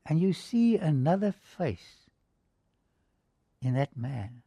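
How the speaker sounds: usual speaking voice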